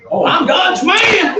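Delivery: loud, slow proclaiming shout. Acted. Preacher shouting to the church. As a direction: cry it out to the congregation.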